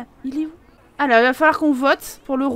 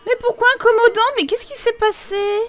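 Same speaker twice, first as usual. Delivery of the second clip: dismayed cry; 9 semitones higher than usual. Real, not acted. Sims couple upset.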